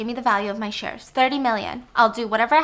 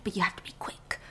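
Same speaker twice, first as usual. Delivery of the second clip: whisper; 3 semitones lower, 11 dB quieter than usual. Acted. Blanc urging secrecy.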